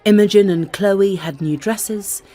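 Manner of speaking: ordinary delivery, nothing marked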